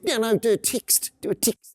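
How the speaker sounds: be the New Zealand mum brushing it off; New Zealand accent. Retold or imitated. Retelling the mum answering her daughter, in a New Zealand accent. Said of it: "your best New Zealand accent".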